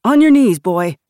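high, very loud yell that drops at the end; yelled command. A narrator's reading. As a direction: yell the order at a child. Jason orders deaf boy down.

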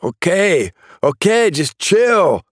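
high, slowed panicked giving in; scared surrender. Acted. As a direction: back down fast, rattled. Suspect gives in under gunfire.

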